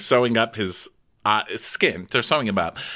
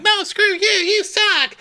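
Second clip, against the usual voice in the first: much higher, louder bratty taunt; bratty kids; 3 dB louder, slower than usual. Retold or imitated. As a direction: sneer it like squabbling kids. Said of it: "And the kids are like".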